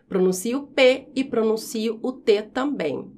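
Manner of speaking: ordinary delivery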